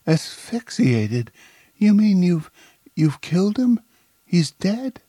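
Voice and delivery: high, stunned questioning; shocked disbelief. A narrator's reading. Woman learns of the accidental death, shocked and disbelieving. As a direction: reel at the news, pitch leaping far up.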